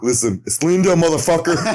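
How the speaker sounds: hammed-up imitation of the angry customer; mock voice from the video. Mocking voice from the video.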